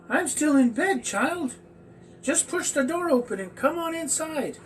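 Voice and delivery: high, falsetto fake grandma; wolf pretending to be a sweet old granny; disguised grandma voice. The wolf calls the girl in, in a high fake grandma voice. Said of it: "It sounded a little different".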